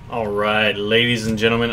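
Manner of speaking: usual speaking voice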